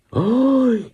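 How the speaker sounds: high startled yelp; startled cry. Acted. Parents seeing the shadow, startled high cry. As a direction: jump with fright.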